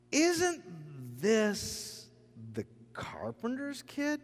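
high, drawn-out disbelief; skeptical question. Acted. Synagogue crowd questioning who Jesus is, high skeptical disbelief. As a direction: pause between words in incredulous questioning.

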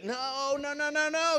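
pitched well up, playful scolding; high repeated no. Real, not acted. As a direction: shake it off with a run of high noes. Rejecting the deflection.

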